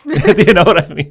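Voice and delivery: laughing through the question; a loud, high burst of laughter while asking; real laugh spilling into the words. Real, not acted. Laughing at his own gas-price joke.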